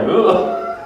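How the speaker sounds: disgusted ugh. Retold or imitated. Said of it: "this dude's like".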